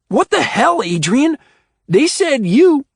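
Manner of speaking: shocked. A narrator's reading. Oscar shocked by accusation.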